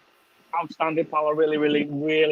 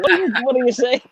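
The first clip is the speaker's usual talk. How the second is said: a high, louder puzzled mock question; confused friend; 8 semitones higher, 4 dB louder than usual. Mocking a friend who can't understand him, high and puzzled. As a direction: imitate a baffled mate who can't follow. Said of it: "He's like".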